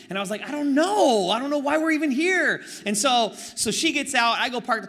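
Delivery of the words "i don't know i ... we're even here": exasperated. Retold or imitated. Exasperated reply to wife.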